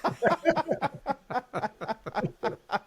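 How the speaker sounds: high, laughing through the name; laughing quote. Retold or imitated. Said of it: "he goes".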